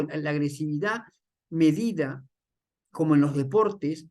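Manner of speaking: normal talking voice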